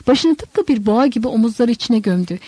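ordinary delivery, nothing marked

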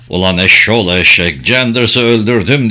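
ordinary delivery, nothing marked

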